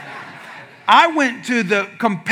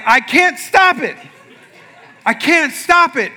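raised, higher-pitched pleading retort; mock helpless protest; 7 semitones higher, 3 dB louder than usual. Husband shaking in bed, to wife, mock helpless protest.

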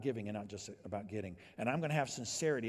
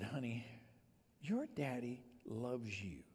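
soft, slow, lowered tender words; 7 dB quieter, slower than usual. Retold or imitated. Father softly telling his daughter he loves her, tender. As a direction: say it gently to your little girl.

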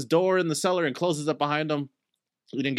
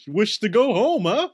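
louder, higher taunting threat; menacing turtle man; 7 semitones higher, 5 dB louder than usual. Acted. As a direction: taunt him while patting the club.

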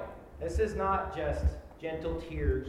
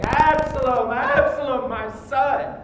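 loud, high anguished wail of a grieving father; David's crying; 9 semitones higher, 12 dB louder than usual. Shown as a demonstration. Preacher showing how David cried for Absalom, loud wailing. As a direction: cry out for a dead son. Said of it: "the way that David cries".

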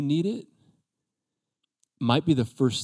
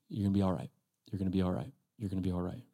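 gentle reassurance; 4 semitones lower, 9 dB quieter, much faster than usual. Retold or imitated. Jesus reassuring disciples.

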